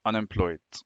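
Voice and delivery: soft, low, slowish "unemployed"; murmured while writing. Shown as a demonstration. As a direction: say "unemployed" quietly to yourself as you write. Writing the word while saying it.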